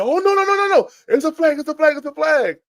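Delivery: very high, loud, panicked protest; frantic rival fan. Retold or imitated. Boston woman insisting the refs threw a flag, in a high frantic squeal. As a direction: squeal in alarm that there is a flag.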